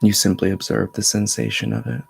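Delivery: measured meditation voice with long pauses; steady, level tone, resting between phrases; guided meditation. Acted. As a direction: guide them inward in an even, unhurried flow. Leads a meditation.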